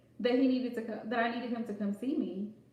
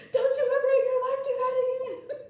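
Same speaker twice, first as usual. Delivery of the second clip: very high, loud mock shriek; scolding mom; 13 semitones higher, 7 dB louder than usual. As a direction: screech it like an outraged mother, about to laugh. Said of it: "and she was like".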